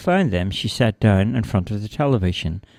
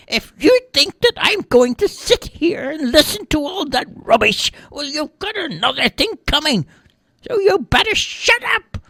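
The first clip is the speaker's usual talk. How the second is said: put-on very high, loud old-lady rant; scolding old lady; 4 dB louder than usual. A narrator's reading. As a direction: tell off the TV like an indignant granny.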